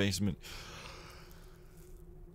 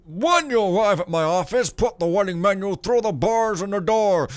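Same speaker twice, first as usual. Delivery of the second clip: high, loud, sing-song theatrical read; theatrical acting voice; 8 semitones higher, 7 dB louder than usual. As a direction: declaim the note like a stage actor. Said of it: "my theatrical acting voice".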